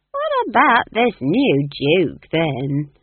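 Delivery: loud, slow change of subject; lightening the atmosphere. A narrator's reading. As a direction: brightly move the talk along, stretching words. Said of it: "to lighten the atmosphere".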